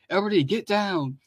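high-pitched cry of alarm, not shouted; urgent warning. Acted. Donatello warns his brothers of a blast, urgent warning. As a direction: warn them urgently without raising the volume.

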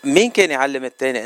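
ordinary talk

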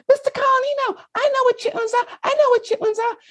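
eager squeaky falsetto; very high little-boy voice; high-pitched kid voice. Retold or imitated. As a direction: imitate a pupil bursting to answer. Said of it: "And he says to me".

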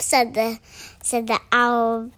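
ordinary delivery, nothing marked

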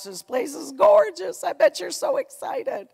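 high, gushing mimicry of visitors raving about the lake; mock gushing visitors. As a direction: coo it like an overeager guest. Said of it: "people would come and they go".

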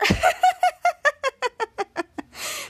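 high staccato cackle, trailing downward; imitated laughter. Piper laughing at the prank, high imitated cackle. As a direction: do a long, high put-on laugh. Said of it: "and there was laughing, there was Piper".

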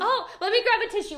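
high, fast, laughing; cracking up while offering help. Real, not acted.

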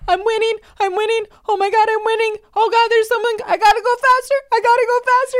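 high, loud excited kid imitation; little baby voice. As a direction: squeal it like a four-year-old racing. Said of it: "you just hear his little voice".

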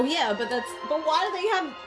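ordinary talk

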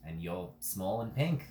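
put-on slow Australian accent. Ghost answering Rose's introduction in an Australian accent. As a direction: take it slowly with an Australian lilt. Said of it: "That was so Australian".

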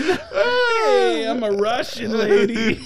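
high, laughing mock Russian lady; badly faked Russian accent. Shown as a demonstration. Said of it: "You wanna hear Russian?".

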